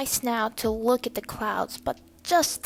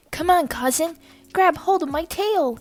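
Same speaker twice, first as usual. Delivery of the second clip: loud, raised urgent yell; screaming; 7 semitones higher, 7 dB louder than usual. A narrator's reading. Rescuer to his cousin in the sea, urgent scream. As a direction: yell urgently over the waves. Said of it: "he screamed".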